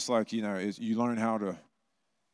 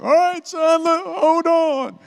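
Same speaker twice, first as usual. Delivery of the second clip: very high, loud, slow stretched dad voice; hammed-up panicking father on the mower; dad steering a mower toward a steep hill, squealing alarm; nervous dad; 13 dB louder, much slower than usual. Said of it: "He's like".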